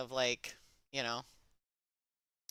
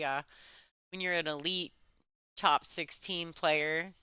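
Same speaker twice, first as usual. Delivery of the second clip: ordinary talk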